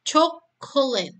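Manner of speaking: Coklat split into three clear parts, co-ko-lat; syllable by syllable. Shown as a demonstration. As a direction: spell out Coklat in three beats. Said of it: "Coklat itu ditulis menjadi tiga bagian".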